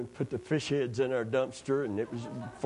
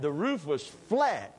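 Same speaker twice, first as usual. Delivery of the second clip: usual speaking voice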